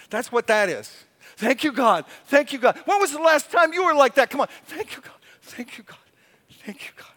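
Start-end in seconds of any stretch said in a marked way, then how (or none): 4.7-7.1 softer whispered thanks; hushed prayer. Shown as a demonstration. Preacher murmuring thanks to God, hushed. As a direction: breathe it like a private prayer.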